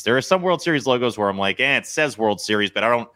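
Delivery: ordinary delivery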